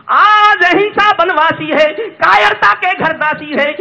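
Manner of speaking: high, loud poetic declamation; ringing, drawn-out recital cry; impassioned verse. Acted. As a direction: belt the verse out to the crowd. Impassioned climax of stage poem.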